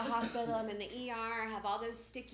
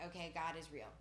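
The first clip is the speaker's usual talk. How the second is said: normal talking voice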